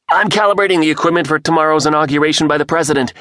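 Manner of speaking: high, loud and fast, words tumbling out; barely able to get his words out; excited rush. A narrator's reading. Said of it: "trying desperately to calm himself".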